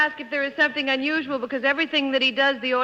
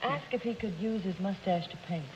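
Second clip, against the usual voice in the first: whispered aside; 3 semitones lower, 11 dB quieter than usual. Real, not acted. Panelist aside to teammate in conference.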